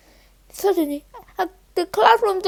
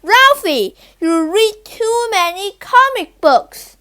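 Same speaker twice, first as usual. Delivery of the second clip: louder, slower telling-off; loud scolding; 4 semitones higher, 4 dB louder than usual. Acted. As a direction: scold him firmly and loud. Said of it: "Keisha scolded loudly".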